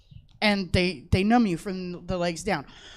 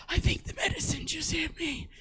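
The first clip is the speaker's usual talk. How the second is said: higher, hushed stoned voice; 7 semitones higher, 11 dB quieter than usual. Retold or imitated. Telling mom at Taco Bell, stoned and hushed. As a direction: sound high for the first time. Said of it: "like when you get high".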